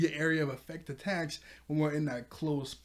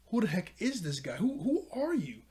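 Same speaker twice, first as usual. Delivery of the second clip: higher, incredulous questioning, imitating a character; retold bewilderment; 7 semitones higher, 4 dB louder than usual. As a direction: act out baffled questions, jumping higher. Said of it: "Hawks is like".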